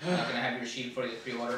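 low, lifeless clerk voice; numb, bored shop clerk; dead voice. Acted. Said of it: "my dead voice".

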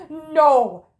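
sharp loud no; loud denial. Acted. Accused character shouting that nobody was murdered.

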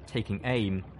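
ordinary delivery, nothing marked

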